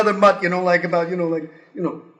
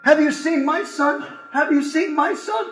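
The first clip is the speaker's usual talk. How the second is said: worried parent asking; 8 semitones higher than usual. Retold or imitated. Parents searching for their son.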